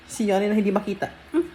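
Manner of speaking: ordinary talk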